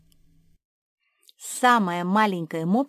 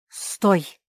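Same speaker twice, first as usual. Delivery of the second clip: sharp command; 6 dB louder than usual. A narrator's reading. Sister grabs the runaway puppy.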